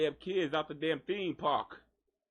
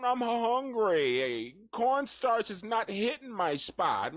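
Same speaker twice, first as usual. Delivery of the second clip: higher mock cat complaining; cat's voice; 5 semitones higher, slower than usual. Voicing the cat complaining about its food, higher mock whine. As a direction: speak for the hungry cat, whiny.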